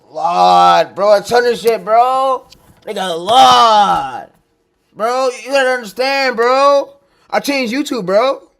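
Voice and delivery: loud, slow, fired-up yelling; hyped shouting. Real, not acted. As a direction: shout it like you mean it. Hyped rant about changing YouTube.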